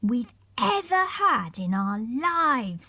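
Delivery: slow, savoured delight. A narrator's reading. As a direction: marvel at the first real adventure. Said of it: "said Molly in delight".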